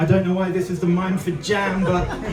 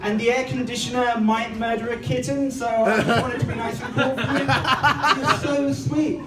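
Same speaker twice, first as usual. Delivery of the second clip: high, sweet-voiced wife calling from the shower; lightly voiced wife, up high; wife's voice; 5 semitones higher than usual. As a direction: play the wife, sugary and higher.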